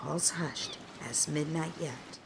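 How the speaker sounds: low, soft whisper. Acted. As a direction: breathe it so no one hears. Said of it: "whispering and trying to remain unseen".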